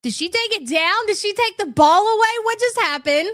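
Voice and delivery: can't believe she pulled it; shocked. Real, not acted.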